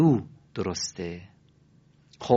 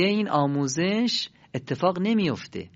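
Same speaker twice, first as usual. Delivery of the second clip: ordinary talk, nothing marked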